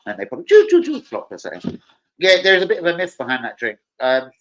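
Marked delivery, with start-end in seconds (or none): 0.5-1.0 high, loud "choo, choo, choo"; imitated choo noises. Streamer mimicking the mishap with the drink, high choo noises. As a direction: squeal out quick comic choo sounds.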